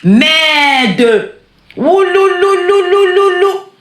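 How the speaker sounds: high, drawn-out excited ululation; excited yell. Real, not acted. Reacting on her live stream with a high drawn-out ululating cheer. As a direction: yell and trill to cheer the moment.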